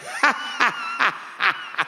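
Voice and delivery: high-pitched staccato cackle; put-on, exaggerated high cackle; Hillary laugh imitation. Preacher mimics a politician's laugh for the congregation, high mock cackle. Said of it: "Here's Hillary's laugh".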